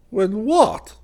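high, loud astonished "When what?"; jolted out of him in surprise; sharp surprised question; astonished demand. A narrator's reading. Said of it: "demanded Hawberk, astonished".

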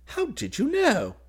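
high, slow, loud amazement; astonished cry. A narrator's reading. Groom amazed that his cousin knows the bride, astonished. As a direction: cry out in astonishment, pitched right up. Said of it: "he cried, astonished".

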